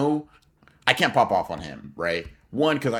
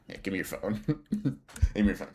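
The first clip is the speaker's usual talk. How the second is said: soft laugh through Give me your phone; half laughing, grabbing for the phone; laughing demand; 6 dB quieter, faster than usual. Retold or imitated. To the cashier, wanting his brother's phone, laughing softly.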